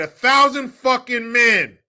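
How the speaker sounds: slow, loud "A thousand fucking men"; angry emphasis. Acted. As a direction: spit it out slowly. Loud correction.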